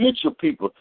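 high, rushed, flustered appeal; flustered plea. Retold or imitated. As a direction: blurt it out in a panic. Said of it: "and I said".

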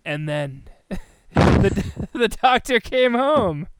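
drawn-out, slow retelling; slow dull storyteller. Pretend. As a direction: drag it out like a boring story. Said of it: "I can imagine Beth at the hospital saying".

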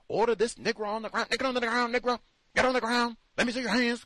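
hammed-up cop ordering a suspect down; mock police commands. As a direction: rattle off urgent arrest commands as police. Acts police ordering man down.

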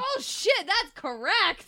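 high, loud, drawn-out realization; mock surprise. Reading a joke card, mock stunned and loud. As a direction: gasp it out like a revelation. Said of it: "And I was like".

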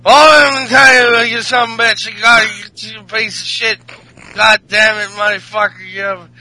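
loud mock-drunk cursing; slurred wrestler tirade that starts high and loud; drunk Sheamus. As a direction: ramble insults like a hammed-up drunk. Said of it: "and Sheamus is like".

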